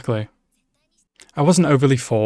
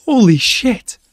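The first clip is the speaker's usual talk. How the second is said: "Holy shit" squealed much higher and louder; shocked exclamation; 11 semitones higher, 5 dB louder, slower than usual. Real, not acted. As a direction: gasp it out in utter disbelief. Stunned by on-screen violence.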